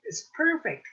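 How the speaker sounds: now really sell it, up high; second It's perfect, lifted high. Acted. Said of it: "he said".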